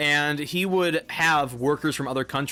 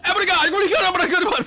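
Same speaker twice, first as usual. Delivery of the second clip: very high, loud babbled yelling; mock shouting; 6 dB louder, faster than usual. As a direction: imitate workers yelling full blast at each other. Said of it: "they sounded like they were shouting at each other".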